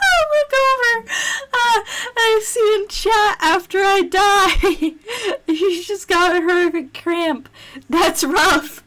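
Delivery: high, breathless through laughter; laughing while talking. Real, not acted. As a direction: speak through giggles, pitch up. Reading chat while laughing.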